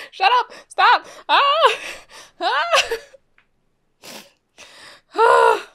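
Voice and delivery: loud, high excited shrieks; thrilled shouting at the screen; fangirl squeals. Real, not acted. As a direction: squeal with delight at the couple. Fan squealing at a romantic moment.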